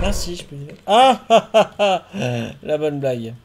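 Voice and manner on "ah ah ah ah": loud laugh. Real, not acted.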